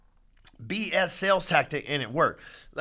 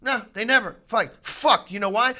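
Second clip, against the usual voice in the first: louder, higher real anger; angry rant; 5 semitones higher, 5 dB louder than usual. Real, not acted. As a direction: hammer it home in frustration. Ranting about rates and prices.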